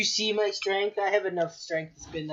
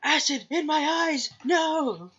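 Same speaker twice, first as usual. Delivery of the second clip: mock pained yell; 11 semitones higher, much slower than usual. Character hit by acid.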